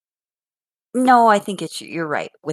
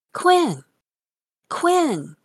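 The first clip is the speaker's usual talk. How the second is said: put-on high-pitched, singsong child's call; little boy calling; 10 semitones higher than usual. Grandmother imitating grandson calling to his sibling, high child's call. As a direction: call out like a small boy wanting attention.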